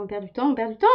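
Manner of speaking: exasperated while forcing a lid; opening a stuck jar. Real, not acted. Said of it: "je galère trop".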